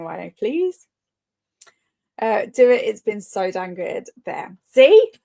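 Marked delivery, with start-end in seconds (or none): 4.7-5.1 triumphant. Real, not acted. Triumphant as friend agrees.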